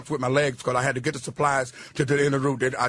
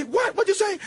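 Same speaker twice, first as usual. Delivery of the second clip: much higher, shocked squeak; startled girl. Retold or imitated. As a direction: jump up in pitch, baffled.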